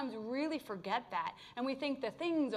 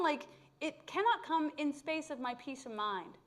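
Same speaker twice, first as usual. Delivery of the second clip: usual speaking voice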